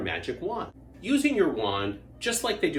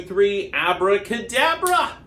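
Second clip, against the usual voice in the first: magic incantation; 6 semitones higher, 8 dB louder, slower than usual. Acted.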